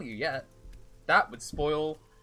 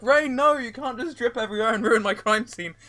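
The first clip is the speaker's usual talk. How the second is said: fussy investigator voice; 9 semitones higher, 9 dB louder than usual. Retold or imitated. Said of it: "forensic investigators be like".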